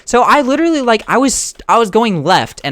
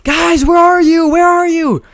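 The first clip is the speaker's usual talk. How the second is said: high, slow calling out across the distance; mock call for lost kids, pitched up; calling out, worried; 12 semitones higher, 3 dB louder, slower than usual. Family searching the street for the missing kids, high worried calling. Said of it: "and yelling".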